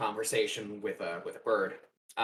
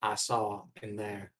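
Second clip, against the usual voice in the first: slow, lower attempt at Morgan Freeman; failed Morgan Freeman try; 5 semitones lower, 5 dB quieter, slower than usual. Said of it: "Ah, can't do it".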